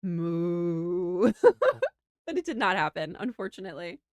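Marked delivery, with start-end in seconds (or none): 0.0-1.3 mock moo. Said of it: "a bald man go".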